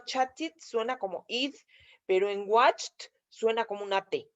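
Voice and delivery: ordinary talk, nothing marked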